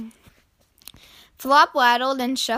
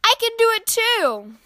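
put-on high childlike insistence; high-pitched little kid voice; eager little brother; 11 semitones higher than usual. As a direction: pipe up high, keen to copy big brother. Little brother copying the older one.

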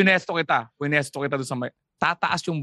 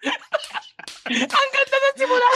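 high, slow line squeezed out through laughter; laughing; much slower than usual. Real, not acted. Laughing at the emotional opening, co-host teases Chopper.

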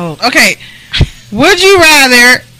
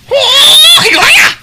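anime shout; 13 semitones higher than usual. Pretend. Joking to the cohost, a high anime battle shout. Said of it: "What if I just go".

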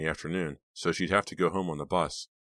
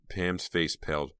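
plain reading voice

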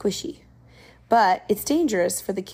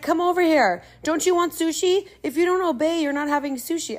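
higher coaxing mom voice; bribing mom; 8 semitones higher than usual. Retold or imitated. Mom coaxing daughter with sushi, higher mock coax. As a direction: sweeten it up like a mother bribing. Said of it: "her mom's like".

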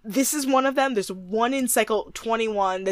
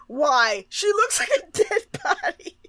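high squeal through laughter; laughing retelling; 9 semitones higher than usual. Retold or imitated. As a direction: crack up while quoting your own protest. Quotes own remark while cracking up.

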